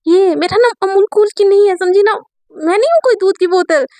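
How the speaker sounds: offended objection, pitch up; annoyed protest. Acted. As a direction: push back hard at the silly nickname. Mayra angrily rejects the nickname.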